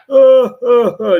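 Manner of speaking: loud high booming "oh, oh" laugh, imitated. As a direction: do his big delighted laugh. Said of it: "the way he laughs".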